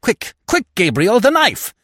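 high, loud, urgent cry; urgent command. Acted. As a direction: call out frantically for help. Widow urgently demands the knife.

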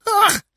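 very high, loud screech; short squawking bird scream; jay's scream. A narrator's reading. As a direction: screech like a startled jay. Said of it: "screamed the jay".

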